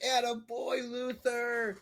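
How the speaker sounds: high, loud, drawn-out cheer; congratulatory cheer. Retold or imitated. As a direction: call it out to congratulate a buddy. Retelling own cheer to the winner.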